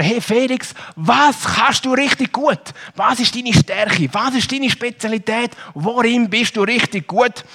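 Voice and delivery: quoting the interviewer. Retold or imitated. Preacher voicing the interviewer asking Felix. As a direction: ask the boy warmly what he is good at. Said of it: "Der Felix ist gefragt worden".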